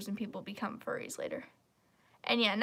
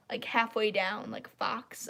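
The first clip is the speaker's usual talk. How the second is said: ordinary talk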